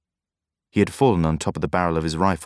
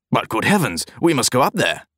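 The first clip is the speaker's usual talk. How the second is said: alarmed cry; 6 semitones higher than usual. A narrator's reading. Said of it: "the bank clerk cried".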